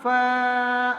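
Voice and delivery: فا with a long, held aa; drawn-out vowel. Shown as a demonstration. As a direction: stretch the aa of فا steadily. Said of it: "کھینچ کر پڑھتے ہیں". (فا کو کھینچ کر)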